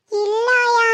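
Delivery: put-on whiny little-girl voice; mildly weepy, put-on child voice; tearful child. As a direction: answer granny like a child in tears. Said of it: "எதுக்கு அழுகுற".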